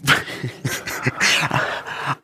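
breathy burst of laughter; helpless chuckling; amused laugh. Real, not acted. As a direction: crack up at the memory. Laughs at friend's fear.

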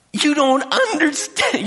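high, slow and insistent; upset pleading. Retold or imitated. Wife repeating to Clint, high and slow pleading. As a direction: drag it out, begging him to get it.

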